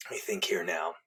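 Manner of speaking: whispered to himself while working out the years; a quick thinking-aloud whisper; whispered aside. Real, not acted.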